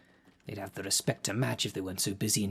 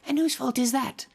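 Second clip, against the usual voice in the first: much higher, louder exasperated retort; exasperated snap; 6 dB louder than usual. Acted. Caretaker scolds the hungry underling, exasperated and loud. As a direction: fire the question back in disbelief.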